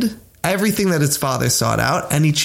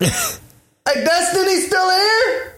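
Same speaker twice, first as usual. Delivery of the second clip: mock shout from away from the phone, much higher; much slower than usual. Phone voice calls to someone else, a mock distant shout. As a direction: call out as if across a room. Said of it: "you hear some shouting off".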